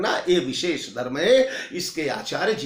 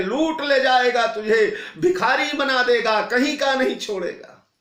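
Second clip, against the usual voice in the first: raised, higher-pitched guru's warning; urgent warning; 5 semitones higher, 3 dB louder than usual. Acted. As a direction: warn a disciple urgently, voice lifted. Shukracharya urgently warns Bali against giving. (चेतावनी भरी ऊँची आवाज़)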